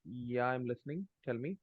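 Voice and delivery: very faint, distant microphone; barely audible. Real, not acted. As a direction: speak far too softly into a bad mic. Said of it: "your voice is very low".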